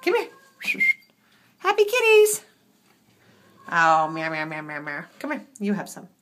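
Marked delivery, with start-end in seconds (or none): 0.0-2.4 high, louder pet coo. Real, not acted. Praising the happy kitties, high baby talk. Cooing over kittens. 3.7-5.0 low-pitched imitation cat meows; mock meowing. Answering a complaining kitten, low mock meows.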